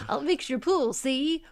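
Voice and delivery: lightly voiced cartoon villain; a hint of a scheming villain, teasing; Snidely Whiplash. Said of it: "they gave a no-bid contract to Snidely Whiplash".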